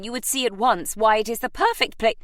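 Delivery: imperious aunt. A narrator's reading. Aunt arguing with her nephew, insistent. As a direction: argue her case as Lady Gosforth.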